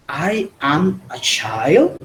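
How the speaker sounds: put-on slow "I am a child" with a steep rise on "child"; question intonation. Shown as a demonstration. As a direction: turn "I am a child" into a question by sweeping "child" upward. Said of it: "haciendo la entonación de pregunta".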